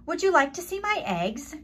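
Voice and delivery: high, quick eager call. A narrator's reading. Hen calling to Clarissa, high and quick. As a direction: call across to the cow, hoping. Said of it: "she called".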